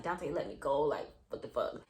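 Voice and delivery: understated exasperation, dropped low; retold annoyed protest. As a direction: protest under your breath. Retold demand to release her arm.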